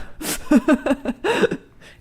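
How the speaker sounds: loud, high burst of laughter; long high-pitched cackling laugh; real laughter. Real, not acted. Laughs at the joke.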